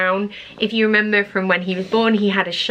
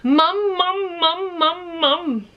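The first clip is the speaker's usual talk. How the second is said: put-on high, sing-song "mum, mum" like a babbling baby; baby babble; 9 semitones higher, much slower than usual. Mum imitating her baby's first babbling "mum, mum". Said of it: "he has also started going".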